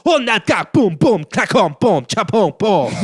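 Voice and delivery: loud, high Jamaican DJ toasting; Jamaican radio DJ. Retold or imitated. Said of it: "He's like".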